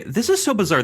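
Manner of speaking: normal talking voice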